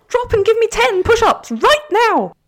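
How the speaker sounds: screaming posh drill instructor; posh drill sergeant. Pretend. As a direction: bark the order like a posh sergeant. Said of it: "yell at you in a very posh way".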